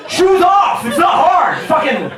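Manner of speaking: high, loud shouted commands; fed-up barked instructions. Retold or imitated. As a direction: yell orders at slow travelers. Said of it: "my rage is just building".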